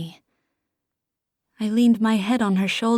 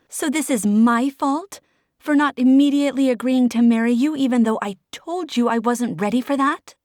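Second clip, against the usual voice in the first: higher and louder, hurt fury; angry outburst; 4 semitones higher, 4 dB louder than usual. Acted. Desi confronting Oliver in a heated fight, higher and louder. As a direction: fling the question back at him.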